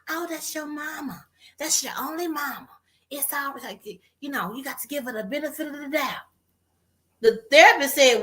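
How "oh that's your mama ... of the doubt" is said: soft, higher-pitched mimicking of well-meaning advisers; placating relatives. Everybody around her excusing her mama, softly placating imitation. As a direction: imitate people hushing her complaints, softly. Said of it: "everybody around me was telling me".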